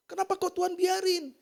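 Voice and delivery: ordinary talk, nothing marked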